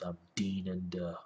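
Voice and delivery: ordinary talk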